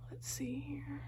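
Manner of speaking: whispered to herself; whispering. Real, not acted. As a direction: breathe it under your breath. Examiner peering into patient's mouth.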